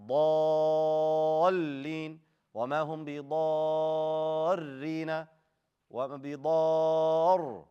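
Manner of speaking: ضالين with a very long vowel, then a push into the doubled ل; nabir emphasis. Shown as a demonstration. Teacher demonstrates nabir, pushing from long vowel into doubled letter. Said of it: "you do nabir".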